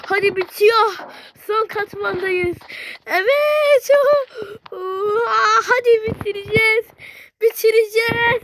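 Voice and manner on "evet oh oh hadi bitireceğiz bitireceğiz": high, loud excited cheering; high and loud, cheering at the finish. Real, not acted.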